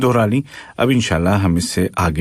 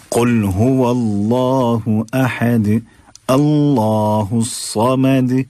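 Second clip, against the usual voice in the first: Quran recitation; 3 semitones higher, much slower than usual. Shown as a demonstration. Teacher recites Ikhlas.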